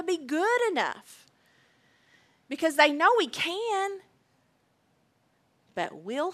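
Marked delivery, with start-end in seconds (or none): none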